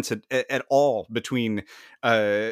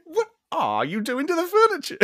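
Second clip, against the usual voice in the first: very high-pitched mock outrage; scandalized mimicry; 3 dB louder than usual. Friend repeating Renfield's line in bed, high mock-scandalized. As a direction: echo the hammy alarmed cry. Echoes the acted Renfield quote.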